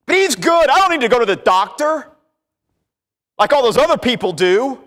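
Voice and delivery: high, loud boastful voice; smug self-praise. Acted. Preacher mocks a smug man who won't see the doctor. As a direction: puff yourself up above others.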